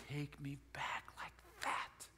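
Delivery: slow, very soft half-whisper; hushed reverie. Retold or imitated. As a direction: drift back into a childhood memory. Reliving the smell of the box.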